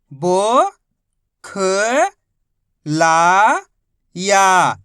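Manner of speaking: each letter held long and loud, a pause after each; syllable by syllable. Shown as a demonstration. Teacher showing learners each letter's sound, syllable by syllable. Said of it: "एक एक अक्षर का उच्चारण करके इनको पढ़ रहा हूँ".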